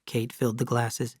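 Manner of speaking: ordinary delivery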